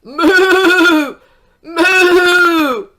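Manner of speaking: high loud laughing moo; cow mooing. A narrator's reading. Spotted cow mooing while being milked, laughing. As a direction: moo playfully like a cartoon cow. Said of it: "said the spotted cow".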